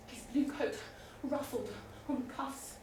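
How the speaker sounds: usual speaking voice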